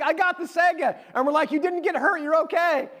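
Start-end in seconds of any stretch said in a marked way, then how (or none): 0.0-1.0 high-pitched mock joyful cry; crying for joy, imitated. Brother getting up after hitting his head, imitated tearful joy. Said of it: "he falls down and he's crying". 1.5-2.9 high-pitched, lightly acted reassurance; mock excited reassurance. Family to brother after his fall, excited mock reassurance.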